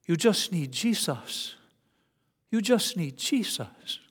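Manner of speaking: put-on light South African accent, soft; gently imitating a South African accent. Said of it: "this South African accent".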